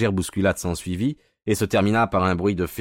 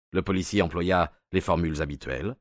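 ordinary delivery, nothing marked

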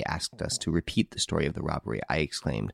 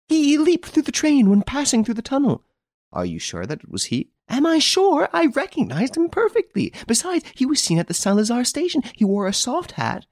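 higher, loud and certain lady; excited woman sure of herself; insistent lady; 4 dB louder than usual. Acted. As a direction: she insists to the commissary. Narrator voices the lady.

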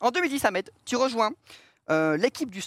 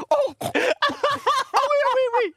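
imitating Ruquier's laugh; 4 dB louder than usual. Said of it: "quand il rigole, il fait un peu ça".

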